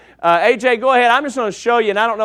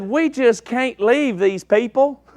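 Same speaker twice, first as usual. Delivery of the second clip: slow, drawn-out East Texas accent, lightly voiced; a hint of an East Texas lady pleading for the villagers; 3 semitones higher, slower than usual. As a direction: imitate a good old East Texas lady, slowly. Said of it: "in the best East Texas accent she could get".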